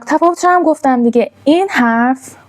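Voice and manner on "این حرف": ordinary delivery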